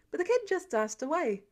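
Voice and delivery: plain reading voice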